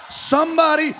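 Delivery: slow, loud, drawn-out declaration rising in pitch; full-force preaching. Real, not acted. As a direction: stretch each phrase into a defiant shout.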